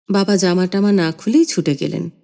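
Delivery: ordinary delivery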